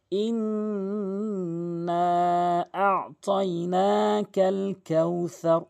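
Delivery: slow, drawn-out chanted recitation; Quran recitation. Shown as a demonstration. Teacher reciting the verse for students, slow chanted tilawat. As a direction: intone it slowly like a reciter in prayer. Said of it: "এভাবে উচ্চারণ করবেন".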